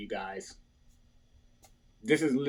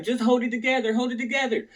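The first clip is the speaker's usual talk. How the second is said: high imitation of a supportive girlfriend; retold girlfriend pep talk, pitched up; girlfriend's encouragement; 7 semitones higher, faster than usual. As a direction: voice your girlfriend steadying you. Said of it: "She's like".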